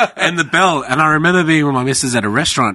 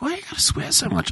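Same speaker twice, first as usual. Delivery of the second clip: mom scolding; 7 dB quieter than usual. Retold or imitated. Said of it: "my mom's like".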